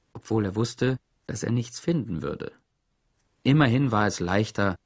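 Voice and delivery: plain reading voice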